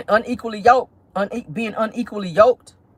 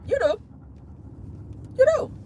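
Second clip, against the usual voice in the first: emphatic high you do; 12 semitones higher, 8 dB quieter than usual. Acted.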